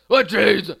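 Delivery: rough, explosive mock bellow; nasty sandwich guy's angry yell. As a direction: bark it at a customer, very loud. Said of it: "a really nasty little monster".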